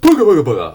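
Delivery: loud yawning through the words; big loud real yawn mid-sentence. Real, not acted. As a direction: yawn out the syllables. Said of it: "Ah, sorry, I'm yawning".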